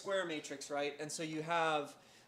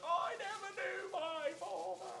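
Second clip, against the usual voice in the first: high, drawn-out wail of a movie shark; high-pitched, slow cartoon lament; movie shark quote; slower than usual. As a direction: wail it long and high, like a melodramatic film line. Quotes movie shark's famous line.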